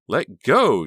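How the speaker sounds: high, loud scream. A narrator's reading. Honey wrestling Bill for the knife, screaming. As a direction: scream at him to let go. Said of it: "she screamed".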